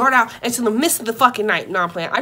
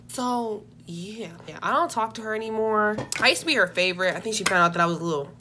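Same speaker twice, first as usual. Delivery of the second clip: ordinary talk, nothing marked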